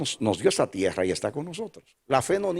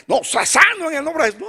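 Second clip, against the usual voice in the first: insistent father; 6 dB louder than usual. Retold or imitated. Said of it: "le digo".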